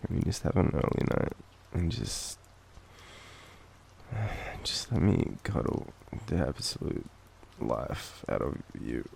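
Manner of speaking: ordinary talk, nothing marked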